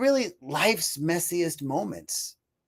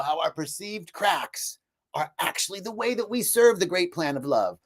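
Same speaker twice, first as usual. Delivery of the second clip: normal talking voice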